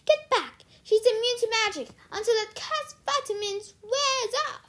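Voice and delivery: high, loud frantic warning; alarmed command. A narrator's reading. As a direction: order them back in alarm. Mistress orders attendant back in alarm.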